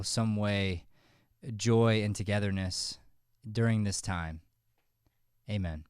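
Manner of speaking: host praying for the audience, soft and earnest; prayer. Real, not acted. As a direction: pray quietly and sincerely. Leads a prayer.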